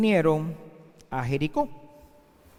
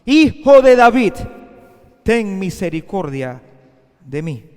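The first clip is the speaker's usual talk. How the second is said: crying out even more; 11 dB louder than usual. Acted. Blind beggar crying out to Jesus over the crowd, loud. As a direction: shout it louder, desperate. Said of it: "él clamaba mucho más".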